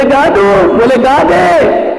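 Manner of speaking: high, loud rising back-and-forth; building shouts. Acted.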